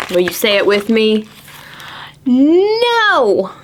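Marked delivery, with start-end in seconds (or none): 2.3-3.5 shouted no. Acted. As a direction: shout it like a stubborn kid. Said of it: "cried Anna".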